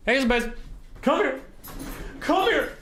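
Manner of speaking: sing-song summons to the dog; high pet-calling voice. Real, not acted.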